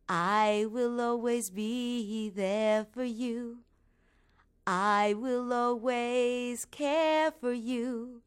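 very slow, tender, drawn-out reassurance; a spirit's lullaby carried on the breeze; ghostly mother on the wind. Acted. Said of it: "her mother's voice ... circling around over and over".

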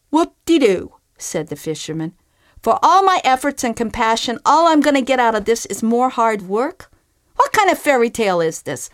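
disappointed fisherman grumbling; sarcastic fisherman. Acted. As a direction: scoff at hard work instead of magic. Fisherman complains.

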